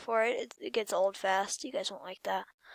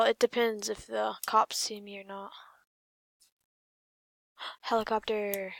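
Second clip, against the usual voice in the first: usual speaking voice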